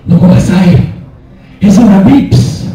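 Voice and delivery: dad bursting in, hammed-up telenovela panic; telenovela Mexican accent. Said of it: "Like a telenovela".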